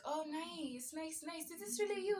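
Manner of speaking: mock eager scammer. Mocking the scammer reacting to the fake photo, high and eager. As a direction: ham up the scammer's delight, climbing high. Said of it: "he was like".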